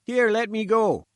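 high, loud sputtering protest. A narrator's reading. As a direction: sputter it out while being held back. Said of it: "he sputtered".

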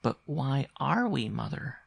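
put-on high, slow child's question; questioning boy. A narrator's reading. Boy asks mother why they are poor, childlike. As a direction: press mother for a reason.

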